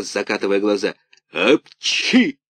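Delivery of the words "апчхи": a big high theatrical sneeze; loud mock sneeze. A narrator's reading. Karabas sneezes by the hearth, loud sneeze. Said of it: "И он чихнул так".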